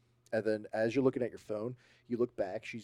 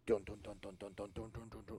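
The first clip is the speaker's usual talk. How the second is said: steady, even thudding "dun"; imitated running footsteps; 9 dB quieter than usual. Shown as a demonstration. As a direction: beat out footsteps running closer. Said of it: "like something running at you".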